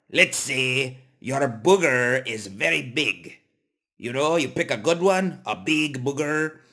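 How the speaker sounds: ordinary talk, nothing marked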